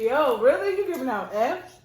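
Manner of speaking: loud, high mock disbelief; incredulous. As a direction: call out the gym teacher in amused outrage. Acting out own incredulous reaction.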